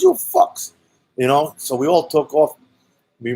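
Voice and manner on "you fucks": very high, furious shouted curse; angry yell. Retold or imitated. Homeowner cursing the fleeing boys, high furious shout. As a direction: spit it up at the boys. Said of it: "And he goes".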